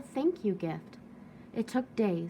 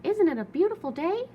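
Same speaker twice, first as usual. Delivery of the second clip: cheerful; 9 semitones higher, 6 dB louder than usual. Acted. Rosie greeting Hank cheerfully.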